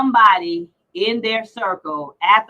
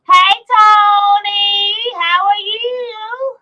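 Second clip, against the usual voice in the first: high, slow, loud put-on caller voice; mock flirty hello, high and drawn out; put-on greeting voice; 10 semitones higher, 6 dB louder, much slower than usual. Mock sultry greeting to Tony.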